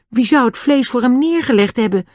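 ordinary delivery

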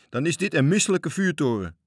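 high, loud, indignant call; heavy-voiced shout. A narrator's reading. Sailor condemns the lighthouse, calling out heavily. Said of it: "riep de zware stem van matroos Gijs".

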